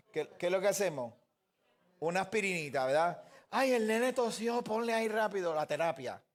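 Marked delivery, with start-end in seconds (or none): 3.5-6.2 mock fussy mother. Pastor mocking parents who panic over a cough, mock fussy tone. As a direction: lightly parody the worried parent.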